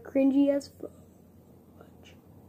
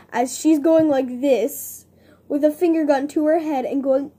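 excited; 10 dB louder than usual. Real, not acted. Excited retelling, loud.

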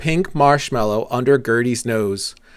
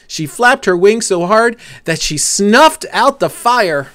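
plain reading voice

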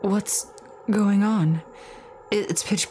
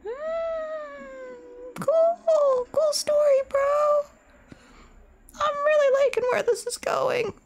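much higher put-on sarcastic voice; mock high voice; slower than usual. Mocks the game's creepy story in a high sarcastic voice. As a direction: squeak it out, dripping sarcasm.

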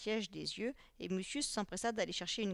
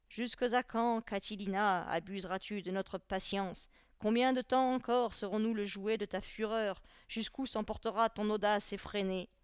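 declaimed speech, pushed a little louder; reading aloud; 5 dB louder than usual. A narrator's reading. Teacher reads the letter aloud to his pupils, declaiming. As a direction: read it out like a public oration. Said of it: "se mit à lire à haute voix".

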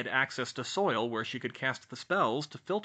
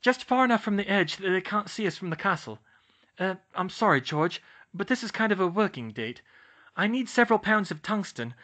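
put-on high, loud earnest girl voice; high, loud Hermione, faster on the tungsten part; 7 semitones higher, 5 dB louder than usual. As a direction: explain the working date, slightly apologetic. Hermione explains her plan.

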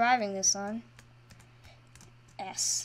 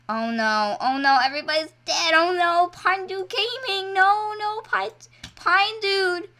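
rising, high, loud wail of dismay; distraught outcry; 9 semitones higher, 5 dB louder than usual. Real, not acted. Player sees everybody's dead and calls to Pine Dude, distraught. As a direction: call out desperately for a lost teammate. Sees favourite teammate die.

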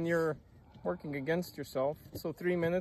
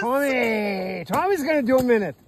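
calling out; 6 semitones higher, 8 dB louder than usual. Real, not acted. Announcing Tommy's plunge.

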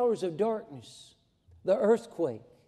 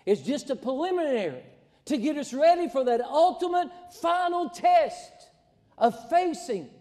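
high, loud, rising preacher's build; preaching at full force; 5 semitones higher, 3 dB louder than usual. Acted. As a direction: climb to a ringing climax.

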